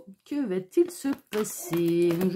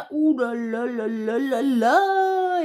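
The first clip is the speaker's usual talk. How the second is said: dramatic ooh-la-la; much slower than usual. Real, not acted. Reacting to the drawn card.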